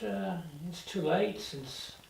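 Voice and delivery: ordinary talk, nothing marked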